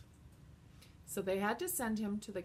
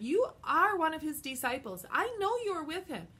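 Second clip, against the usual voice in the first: put-on higher, louder insisting girl voice; 7 semitones higher, 6 dB louder than usual. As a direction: accuse him in a high voice.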